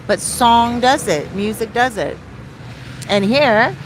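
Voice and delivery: ordinary talk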